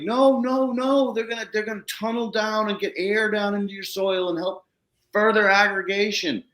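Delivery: high-pitched, eager explaining; retold gushing, pitched well up; excited reassurance. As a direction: act out enthusiastic correcting of a worrier. Retold excited reassurance about earthworms.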